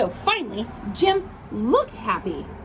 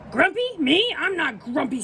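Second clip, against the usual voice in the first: indignant denial; 6 semitones higher than usual. Acted. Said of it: "said Jim".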